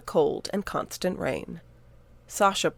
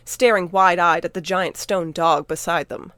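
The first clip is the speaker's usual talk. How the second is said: plain reading voice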